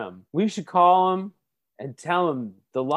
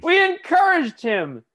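mocking echo; 11 semitones higher, 5 dB louder than usual. Mocking repeat of partner.

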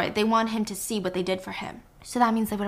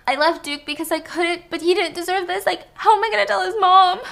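higher, voice cracking, retold crying; mock sobbing; 8 semitones higher, 4 dB louder than usual. Whiskey tearfully telling Helen about leaving Duke, mock sobbing. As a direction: play a hint of breaking down in tears. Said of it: "full on sobbing".

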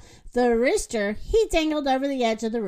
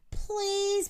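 higher, softer plea; pleading; 7 semitones higher, 6 dB quieter than usual. A narrator's reading. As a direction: coax gently in a raised pitch. Moo begs the rooster.

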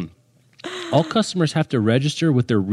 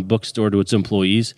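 ordinary talk

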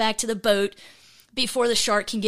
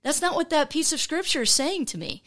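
dismissive excuse; 6 semitones higher than usual. Retold or imitated. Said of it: "we can say".

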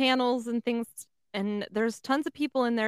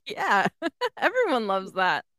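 laughing through the words; 4 dB louder than usual. Real, not acted. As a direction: answer while laughing. Laughing reply.

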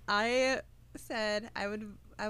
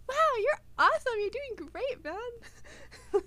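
much higher and louder, fast gushing praise; enthusiastic mom; 14 semitones higher, 5 dB louder, slower than usual. Retold or imitated. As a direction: cheer like a mom hyping up her kid. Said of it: "She goes".

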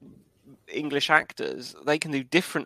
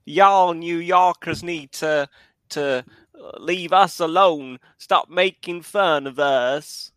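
slow Southern drawl, Forrest Gump style; slow Deep South accent; 4 dB louder, slower than usual. Acted. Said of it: "That's a bit Forrest Gump".

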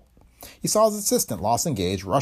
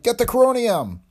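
high-pitched shouted order; urgent cry; 12 semitones higher, 4 dB louder than usual. A narrator's reading. Professor ordering colleague to fetch the gas, urgent cry. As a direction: call out an emergency command, pitched high. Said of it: "Calthay cried".

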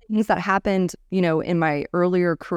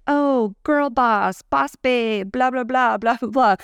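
higher, slow, sing-song mockery of girl boss talk; mock the phrase she hates, pitched up and drawn out; 5 semitones higher, slower than usual. Mocking buzzwords she dislikes, raised and stretched. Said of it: "I actually don't like this whole".

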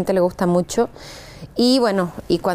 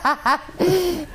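laughing; 8 semitones higher than usual. Real, not acted. Guest laughs at question.

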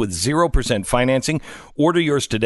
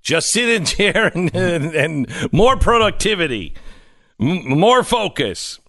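higher, louder hammed-up warlord pitch; Chinese warlord; 6 semitones higher, 3 dB louder than usual. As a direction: bark it like a mock warlord. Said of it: "I sound like a Chinese warlord".

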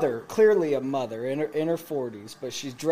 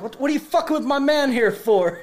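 higher-pitched retold challenge; mock confrontation; 8 semitones higher, 4 dB louder than usual. Teller shoving Jade to defend his new friend, confrontational. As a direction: square up to him in playful anger.

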